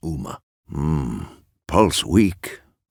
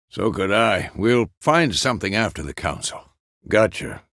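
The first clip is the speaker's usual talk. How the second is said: ordinary delivery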